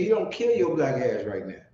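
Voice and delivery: ordinary talk, nothing marked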